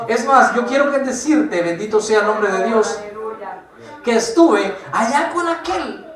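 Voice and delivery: builds to loud, ringing preaching, pushing the church to wake up; fiery preaching. Acted. Preacher urging the church not to be lukewarm, fiery preaching. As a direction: preach it with rising fire, voice swelling on each appeal.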